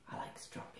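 a secret whisper. Real, not acted. Miss Kathy sharing a secret with the children, whispered.

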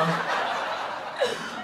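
long breathy laugh rising high. Real, not acted. Vlogger amused by her own jail verdict, laughing. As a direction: crack up into an airy, high laugh.

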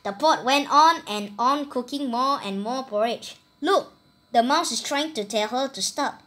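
ordinary delivery, nothing marked

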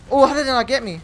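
ordinary talk

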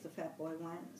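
usual speaking voice

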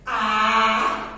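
"A" held long, low and level; reading by syllables. Shown as a demonstration. Teacher reading the sentence with children, syllable by syllable. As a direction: read "A" as one drawn-out beat. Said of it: "Vamos ler a frase".

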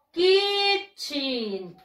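Kitchen drawn out, clear "ch"; model pronunciation. Shown as a demonstration. Teacher leading class repetition of Kitchen, clear. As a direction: say Kitchen slowly for the class to repeat. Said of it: "Repeat after me".

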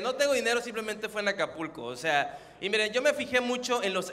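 ordinary delivery

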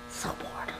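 barely audible, voiceless sigh of boredom; bored whisper. Real, not acted. Bored, waiting alone at work.

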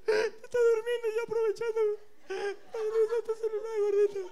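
high, whiny fake crying; mock sobbing apology. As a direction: blubber an apology.

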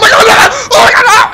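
high shrieking panic; panicked scream. Real, not acted. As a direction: scream it out in terror. Character dies in game.

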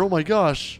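usual speaking voice

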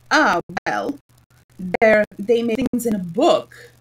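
ordinary delivery, nothing marked

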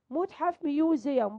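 ordinary talk, nothing marked

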